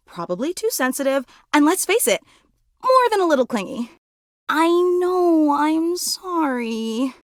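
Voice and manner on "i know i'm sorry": long, stretched-out wail of sorry; drawn-out apology. Acted. Overly emotional fan apologising, plaintive and drawn out. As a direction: drag every word out in a plaintive apology.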